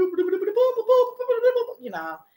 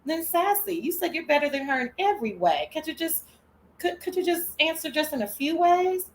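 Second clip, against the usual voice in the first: higher, mock-sweet questioning; sweetly patient host; 7 semitones higher than usual. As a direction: play a sugary, patient interviewer pressing a point. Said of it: "Miss Toby said".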